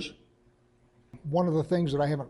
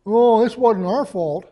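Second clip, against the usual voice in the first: a drawn-out, louder whine of blame-dodging; mock whining; 5 semitones higher, 9 dB louder than usual. Israel denying blame to God, in a slow, loud mock whine. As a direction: whine it slowly like a child denying fault. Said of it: "he's not whining, saying".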